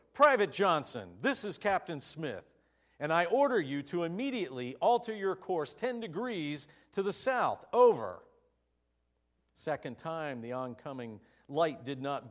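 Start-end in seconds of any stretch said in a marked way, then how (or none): 0.1-8.2 loud, commanding shout over the radio; louder and louder to a booming Over; shouted order. Acted. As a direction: bark the order like a furious captain. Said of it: "the captain shouted back over the radio".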